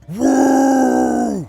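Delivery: high, loud stiff yell of a woo; a dutiful bellowed woo; forced shout. Shown as a demonstration. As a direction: shout it because you're told to.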